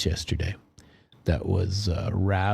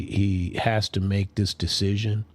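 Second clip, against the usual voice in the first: ordinary talk, nothing marked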